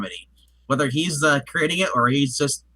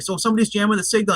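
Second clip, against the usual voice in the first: hacker's quick worried line; 7 semitones higher, faster than usual. Retold or imitated. Retelling a movie scene dialogue.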